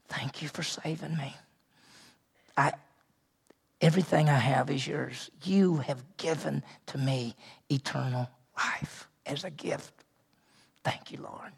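soft, breathy whisper of thanks to God; whispered prayer. Shown as a demonstration. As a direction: pray it under your breath, humbly. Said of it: "ought to say to God".

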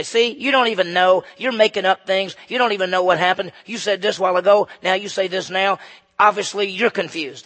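loud, punchy mock accusation; accusing. Opponents trying to trip up the witness, accusing. Opponents imagined pouncing on his contradictions.

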